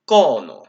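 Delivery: "kono" with a loud, steep fall on the first o. Shown as a demonstration. Conlanger presenting the finished word "kono", falling tone on the first o. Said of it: "with a falling tone on the first O".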